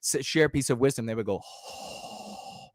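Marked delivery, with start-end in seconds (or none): 1.4-2.7 soft voiceless gasp of awe; a hushed mock sigh of wonder; breathy awed oh. Fans reacting to the guru's wisdom with a mock awed gasp. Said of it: "That would be the sound you'd have to make".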